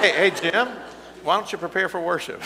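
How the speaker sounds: loud, high call across the room. Real, not acted. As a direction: call out to Jim, laughing. Calling out across the church.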